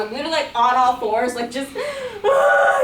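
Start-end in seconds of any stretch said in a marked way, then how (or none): 1.7-2.8 long, very high acted wail; mock bawling wail. Speaker on all fours over the dog, acted bawling. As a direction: let out a drawn-out, hammed-up bawl. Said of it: "like, bawling over this dog".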